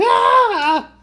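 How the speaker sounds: loud, high startled yell; startled scream. Retold or imitated. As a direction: shriek in sudden fright. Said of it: "are you gonna be like".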